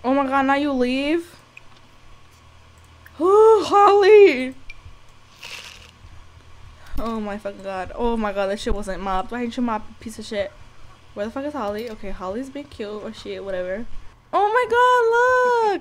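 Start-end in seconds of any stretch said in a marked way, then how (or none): 3.2-4.5 excited squeal. Real, not acted. Spots the dog Holly. 14.3-15.8 amazed gasp. Real, not acted. Sees the pet.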